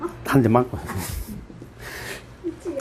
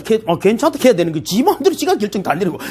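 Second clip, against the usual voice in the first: usual speaking voice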